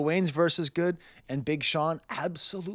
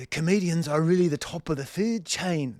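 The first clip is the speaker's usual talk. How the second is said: higher, hammed-up Irish accent; bad Irish accent; 4 semitones higher, 4 dB louder than usual. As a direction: attempt an Irish brogue and botch it. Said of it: "That's not how Irish people talk".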